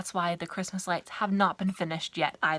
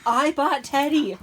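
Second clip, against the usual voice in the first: playful bickering; 6 semitones higher, 8 dB louder, slower than usual. Real, not acted. Sisters bickering over teddy.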